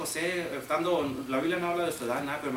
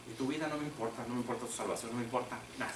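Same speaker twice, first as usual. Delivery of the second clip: cold hush; 3 semitones lower, 6 dB quieter, faster than usual. Acted. Voicing the brothers' cold indifference.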